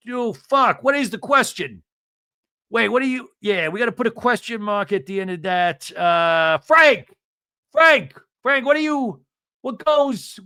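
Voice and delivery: high-pitched, flustered man hunting for words; Joey Gorga. Retold or imitated. Husband asking Frank about punctuation, high and befuddled. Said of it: "Joey Gorga would be like".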